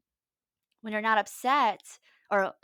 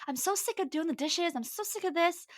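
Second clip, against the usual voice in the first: retold frustrated outburst; 9 semitones higher than usual. Worn-out partner snapping over doing the dishes, frustrated. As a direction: re-enact snapping in exasperation. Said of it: "I kind of snapped".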